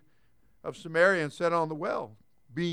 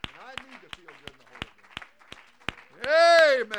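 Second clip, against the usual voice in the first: hush then burst; 3 semitones higher, 6 dB louder than usual. Real, not acted. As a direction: whisper it, then shout the last word.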